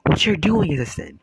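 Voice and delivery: high, loud indignant yelling; guy going off. Retold or imitated. Bi guy yelling at the girls, outraged. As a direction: yell it back at them, outraged. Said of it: "He was like".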